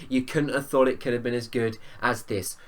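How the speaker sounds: ordinary talk, nothing marked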